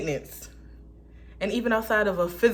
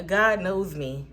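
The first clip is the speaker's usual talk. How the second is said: ordinary talk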